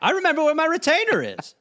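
high, quick, dopey voice; goofy victim voice. Pretend. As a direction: play a dazed guy cheerfully rattled. Joking as shot man.

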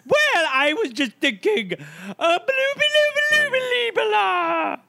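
high silly babbling nonsense; goofy senator babble. Pretend. Playing Senator Silly to the Senate, high goofy babble.

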